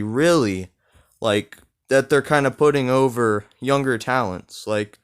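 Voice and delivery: ordinary talk, nothing marked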